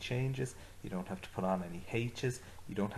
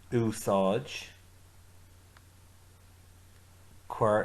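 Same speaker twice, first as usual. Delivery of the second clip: firm orders; 3 dB louder, much slower than usual. Shown as a demonstration. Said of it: "Say it after me".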